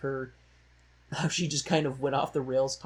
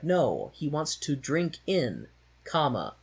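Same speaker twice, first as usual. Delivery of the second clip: slow, patient explaining, pausing at comma; 3 dB louder, slower than usual. Retold or imitated. Explaining slowly to a confused friend. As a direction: spell it out slowly. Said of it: "But then you were like".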